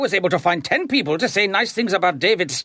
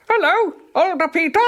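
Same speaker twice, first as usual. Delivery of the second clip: higher, sing-song voice for the dummy; ventriloquist dummy voice; 8 semitones higher than usual. Acted. Therapist voicing a dummy to Peter, higher and cheery. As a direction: play the dummy as his younger self, cheerfully. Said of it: "imagine this dummy is your younger self".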